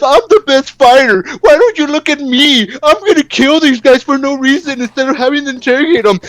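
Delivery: high, bratty voice mocking a self-absorbed fighter; whiny mocking Sub-Zero. Reviewer mocking Sub-Zero as a whiny show-off, high bratty voice. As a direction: sneer it out like a spoiled brat boasting. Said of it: "all he does is bark at people".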